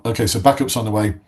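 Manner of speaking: ordinary delivery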